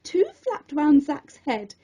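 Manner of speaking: ordinary delivery, nothing marked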